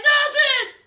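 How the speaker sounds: high-pitched mock-tearful denial; mock crying. Fiancée denying anything is wrong, mock crying. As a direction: squeak it like a hammed-up crier. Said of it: "and she starts crying".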